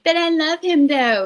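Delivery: high, pleading mock defence of a bad boyfriend; mock lovestruck plea. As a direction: whine it up high like a smitten fool. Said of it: "And I was like".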